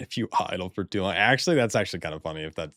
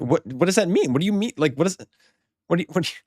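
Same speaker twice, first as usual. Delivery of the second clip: shrill, much higher indignant "what do you mean"; flustered celebrity disbelief, pitched way up; Jennifer Lawrence bit; 9 semitones higher, 4 dB louder, faster than usual. Pretend. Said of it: "why am I doing the Jennifer Lawrence bit".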